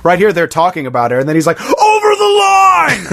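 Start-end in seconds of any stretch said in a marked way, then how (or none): 1.6-3.1 high, slow hammed-up yell; mock outraged shout; shouted quote. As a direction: bellow it like an outraged bowler. Walter yelling quote.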